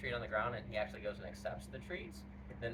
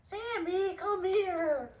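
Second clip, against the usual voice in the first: high, slow sing-song coaxing; 8 dB louder, much slower than usual. Real, not acted. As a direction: coo at the puppy. Trainer coaxing dog sweetly.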